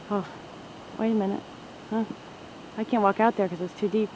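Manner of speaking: ordinary delivery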